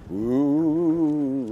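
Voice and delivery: mock ghostly ooh. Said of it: "just to see how afraid I could make them".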